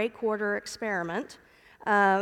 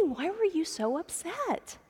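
gentle father; 7 semitones higher, 4 dB quieter than usual. Retold or imitated. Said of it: "Tripp got down and, and said".

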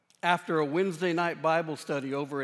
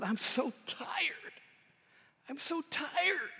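slow, weary, softer repetition; weary plea; 8 semitones higher, 7 dB quieter, slower than usual. Retold or imitated. Pastor voicing the troubled man, slow and weary. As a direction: let the tiredness drag each word. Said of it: "He just said".

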